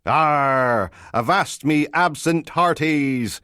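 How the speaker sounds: slow, loud, high pirate cry; pirate impression. Constable jokes the office is a pirate ship, hammed-up pirate voice. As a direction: growl out a drawn Arr like a pirate. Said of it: "like a haunted pirate ship".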